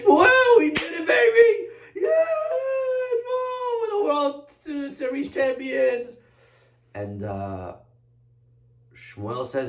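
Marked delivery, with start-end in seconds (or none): 0.0-6.2 high, drawn-out victory holler; all excited. Acted. Victorious king hails the prophet back from battle, wildly excited. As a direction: crow it like a triumphant champion. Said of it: "all excited".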